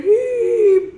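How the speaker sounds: a sharp high-pitched squeal of fright. Acted. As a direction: squeal like a startled toy. Character squeals.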